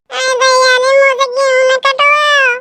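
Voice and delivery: hammed-up tearful plea, slowed; mock wailing plea. Woman begs the boss with fake tearful wailing. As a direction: wail it like fake tears. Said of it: "करने लगी drama".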